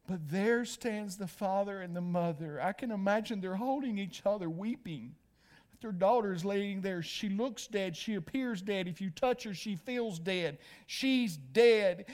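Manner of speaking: usual speaking voice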